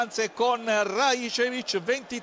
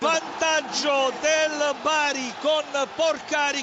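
excited goal call; 2 semitones higher, 3 dB louder, slower than usual. Real, not acted. Announcing Bari goal.

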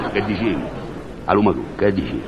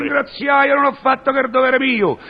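high, pompous self-proclamation; grandiose mystic; 10 semitones higher than usual. Acted. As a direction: proclaim yourself a great seer. (tono solenne e pomposo)

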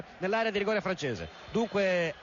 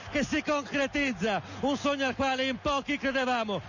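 fast radio sports commentary; live radio commentary; 5 semitones higher, slower than usual. Real, not acted. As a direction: call the match live. Live play-by-play.

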